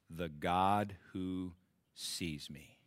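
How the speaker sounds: slowed, voiceless hush on the last words; whisper. Acted. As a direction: breathe the words as a secret comfort. Reading scripture, tender climax.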